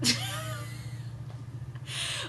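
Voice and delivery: breathless, airless laugh; real silent wheeze of laughter; wheezing silent laugh. Real, not acted. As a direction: laugh with no voice behind it. Cracking up at the chapter.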